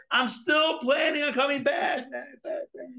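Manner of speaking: high call that fades into echoing backs; calling from afar, fading. Retold or imitated. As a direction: call back as you walk away. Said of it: "further away".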